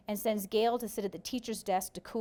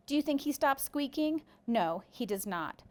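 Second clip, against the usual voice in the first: usual speaking voice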